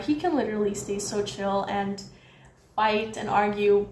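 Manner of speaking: ordinary talk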